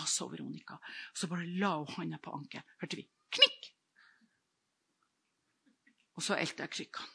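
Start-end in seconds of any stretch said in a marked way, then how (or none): 3.3-3.7 a sharp high "knikk" like a bone snapping; imitated crack. Healed woman imitating the crack of her ankle, sharp and high. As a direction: snap the sound out high and quick.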